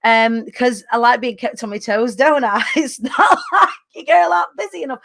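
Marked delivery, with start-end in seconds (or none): 2.2-5.0 laughing self-tease. Real, not acted.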